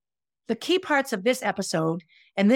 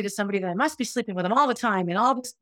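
ordinary delivery

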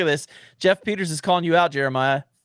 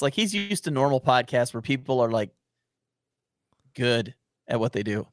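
usual speaking voice

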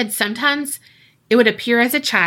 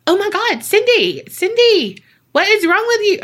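shrill-high panicked mock voice calling her; high, insistent imitation of a worried man; high-pitched; 10 semitones higher, 3 dB louder than usual. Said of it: "uh, high-pitched".

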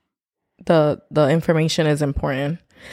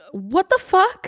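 high, incredulous cry; shocked disbelief; 13 semitones higher, slower than usual. Retold or imitated. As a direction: react in total disbelief. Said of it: "And I'm like".

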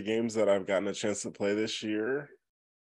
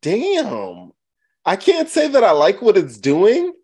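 indignant; 11 semitones higher, 10 dB louder than usual. Real, not acted. Indignant defence against teasing.

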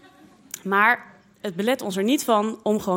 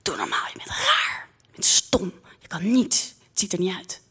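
harsh half-whispered scolding; spiteful, secretive telling-off; hissed abuse. Acted. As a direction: spit out each insult in a hiss. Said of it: "fluister ik er toe".

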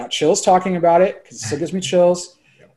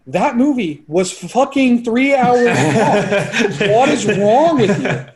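mock exasperated friend, pitched higher and slowed, breaking into a laugh; incredulous friends; 7 semitones higher, 4 dB louder, much slower than usual. Friends mocking him for wanting to rewatch a three-hour movie, high incredulous voice. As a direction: play the stunned buddy who can't believe he sat through it, high and drawn out. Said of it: "They're like".

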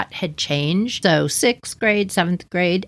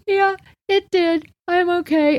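higher voice hiding the pain; brave face; 11 semitones higher than usual. Retold or imitated. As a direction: pretend it's fine while trying not to cry. Hurt girl brushing it off.

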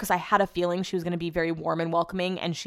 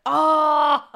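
loud, high drawn-out "oh"; retold outburst of dismay; dismayed exclamation; 8 semitones higher, 6 dB louder than usual. Podcaster re-enacts hearing their first pick was taken, dismayed.

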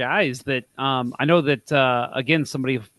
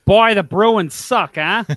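louder, slow mock Boston jeer; bad Boston accent; 3 semitones higher, 4 dB louder than usual. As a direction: lay on a clumsy Boston accent. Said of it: "well, that's terrible".

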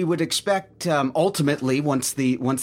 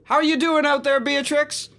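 much higher, louder call across distance; 12 semitones higher, 3 dB louder than usual. Acted. The manager calls out to injured Beatrix, raised voice. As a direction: shout out to check on her.

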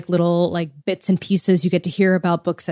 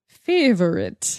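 slow drawn-out New Zealand favourite; mock New Zealand accent; slower than usual. Shown as a demonstration. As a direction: say Favorite the Kiwi way, stretched slow. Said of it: "I say it that way because he's from New Zealand".

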